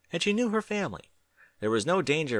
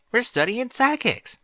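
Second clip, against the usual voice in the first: put-on much higher, louder young filly voice; Apple Bloom voice; 14 semitones higher, 6 dB louder, slower than usual. A narrator's reading. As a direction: pitch it up as an eager young filly. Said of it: "answered with a grin".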